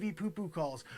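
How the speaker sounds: normal talking voice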